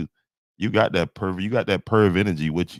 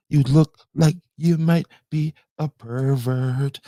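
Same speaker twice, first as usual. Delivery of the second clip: higher and louder, singsong taunt; mocking high voice; 8 semitones higher, 5 dB louder than usual. Real, not acted. Streamer repeating a jeer at a viewer, high taunt.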